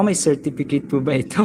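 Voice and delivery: ordinary talk, nothing marked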